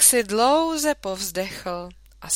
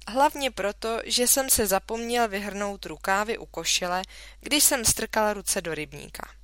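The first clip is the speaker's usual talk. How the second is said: ordinary delivery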